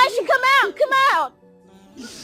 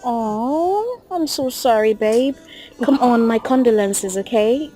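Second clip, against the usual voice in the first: low-pitched, tender sympathy; sorry and consoling; condoling; 8 semitones lower, slower than usual. Acted. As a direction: offer comfort in a lowered voice. Offers condolences to bereaved partner.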